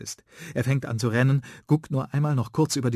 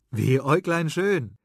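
high, louder excited call; 6 semitones higher, 4 dB louder than usual. Acted. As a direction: burst in with enthusiasm. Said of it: "ruft begeistert".

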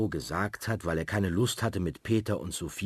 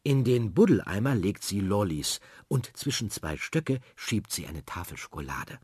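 usual speaking voice

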